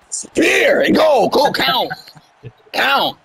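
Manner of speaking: high, excited shout at the game; urgent calling out. Real, not acted. Telling the game to count the pin, urgent shouting. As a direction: yell the commands, drawn out and high.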